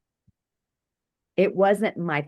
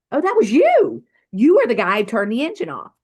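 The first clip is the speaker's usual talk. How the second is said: much higher and louder, rushing fast; excited recognition; 7 semitones higher, 8 dB louder, faster than usual. Retold or imitated. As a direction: blurt out the realization in a fast rush. Recognizing the policeman at the accident.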